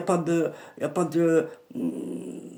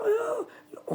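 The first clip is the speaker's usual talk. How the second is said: a high sobbing wail; high crying wail; 6 dB quieter than usual. Retold or imitated. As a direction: let out a high mock cry. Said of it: "Il a commencé à pleurer". (gémissement aigu et pleuré)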